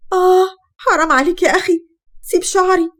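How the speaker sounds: high, loud cry of pain pleading to let go. Acted. Wife begging her husband to let go of her hair, crying out in pain. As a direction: wail as your hair is yanked.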